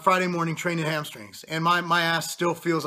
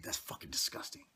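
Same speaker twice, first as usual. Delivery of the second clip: disgusted aside; 9 semitones higher, 15 dB quieter than usual. Real, not acted.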